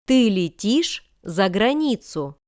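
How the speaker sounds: usual speaking voice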